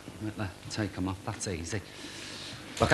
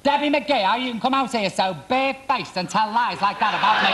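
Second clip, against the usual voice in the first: high, loud indignant accusation; hammed-up furious denial; mock outrage; 9 semitones higher, 3 dB louder than usual. Rival accusing Debbie of lying, high mock outrage.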